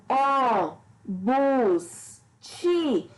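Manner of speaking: "ó, bus, ti" separated, each drawn out and firm; isolated stressed syllables. Shown as a demonstration. Teacher picking out the tonic syllables, "ó, bus, ti" each stressed alone. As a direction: pick out "ó", "bus", "ti" one at a time, strongly. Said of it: "Essa alternância de intensidade das sílabas".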